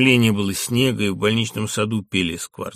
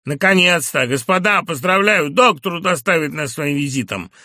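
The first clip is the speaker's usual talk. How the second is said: mocking shout; 5 semitones higher, 6 dB louder than usual. A narrator's reading. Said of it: "крикнул он и захохотал".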